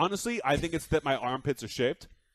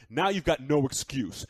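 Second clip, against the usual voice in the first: ordinary talk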